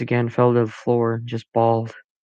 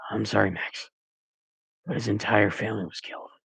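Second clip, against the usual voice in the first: softer, gentle grieving voice; soft sad father; 7 dB quieter than usual. A narrator's reading. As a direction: break it to him softly. Father delivers grief, soft.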